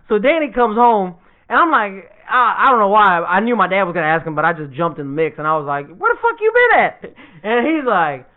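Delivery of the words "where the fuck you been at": high-pitched, acted confrontation; comic indignant demand; mock scolding. Confronts brother coming home late.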